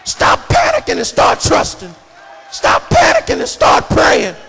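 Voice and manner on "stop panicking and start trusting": normal talking voice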